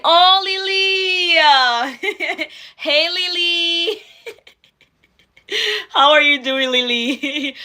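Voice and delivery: high, drawn-out happy calls with laughter; light up and sing her name out; delighted greeting. Real, not acted. Host joyfully greets Lily joining the stream, delighted and laughing.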